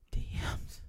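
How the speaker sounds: voiceless whispered punchline; hushed whisper. Pretend. Joking to a buddy that it's going down, whispered. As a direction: drop to a whisper. Mock-secret aside about going down.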